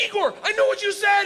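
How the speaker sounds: high excited yell, proud he understood; excited shout. Retold or imitated. To Igor at the bar, an excited high shout.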